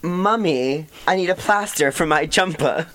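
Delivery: badly faked British child, high-pitched; British accent. Shown as a demonstration. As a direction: attempt a pleading English kid's voice. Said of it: "say the British equivalent of it".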